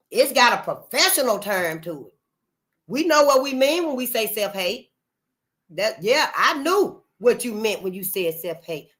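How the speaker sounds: normal talking voice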